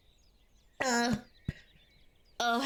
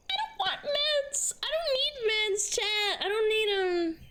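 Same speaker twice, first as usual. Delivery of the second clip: high whiny voice; 7 semitones higher than usual. Pretend. High mock whiny protest.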